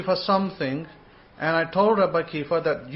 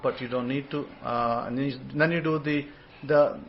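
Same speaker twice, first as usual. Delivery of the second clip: usual speaking voice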